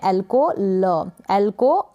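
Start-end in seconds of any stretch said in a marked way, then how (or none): 0.6-1.1 "ल" as a drawn-out l sound; teacher slowly demonstrating "ल"; letter sound. Shown as a demonstration. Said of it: "pronounce कर रहे हैं".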